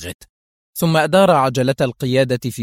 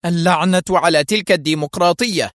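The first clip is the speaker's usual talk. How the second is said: higher, harder angry curse; a boss raging at the rules; indignant curse; 5 semitones higher than usual. A narrator's reading. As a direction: spit out the contempt. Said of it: "قال فوستر في سخط".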